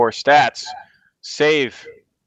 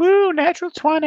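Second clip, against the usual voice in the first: excited cheer. Real, not acted. Player cheers a roll.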